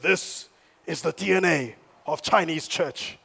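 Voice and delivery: put-on a light Chinese accent on the quote. Pastor quoting the underground church leader, in a light Chinese accent. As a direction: slip back into the mock Chinese accent. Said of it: "That's my Chinese accent now".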